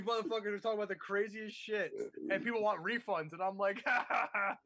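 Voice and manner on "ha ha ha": high imitation of howling laughter. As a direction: act out a helpless cackle. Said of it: "when I'm howling".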